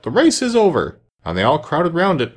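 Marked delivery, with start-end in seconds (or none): 0.0-1.0 high, loud announcement; calling out. A narrator's reading. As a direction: call it out high. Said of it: "the dodo suddenly called out".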